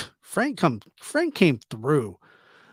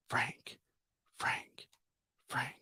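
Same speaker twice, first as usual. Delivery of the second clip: whisper; 17 dB quieter, much slower than usual. Real, not acted. Calling Frank under breath.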